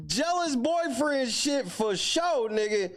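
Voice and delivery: slow, high-pitched amused outburst; amused high squeal. Real, not acted. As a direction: stretch it out high, tickled by the story. Comments while cracking up at story.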